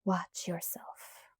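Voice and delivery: slow, flat, threatening whisper; a quiet deadly warning; menacing whisper. A narrator's reading. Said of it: "His voice was flat and frightening".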